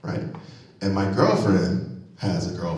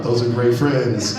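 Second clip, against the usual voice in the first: ordinary delivery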